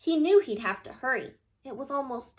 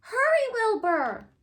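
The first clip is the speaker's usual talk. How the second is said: much higher, loud hurry call; shout; 11 semitones higher, 5 dB louder, slower than usual. A narrator's reading. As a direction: press him to go now. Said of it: "his friends shouted".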